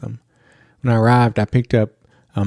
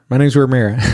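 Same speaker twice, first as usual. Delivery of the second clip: "My name's Ramira" run together quickly, louder; rushed name, imitated; 3 semitones higher, 5 dB louder, faster than usual. Little girl telling the driver her name, rushed and run together. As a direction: blurt the name out in one quick burst. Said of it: "why don't you slow it down a little bit".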